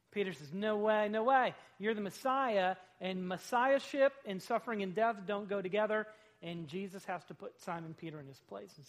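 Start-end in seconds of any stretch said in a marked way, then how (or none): 0.5-6.1 loud protest. Acted. Peter refusing Jesus' plan to die, loud insistent protest.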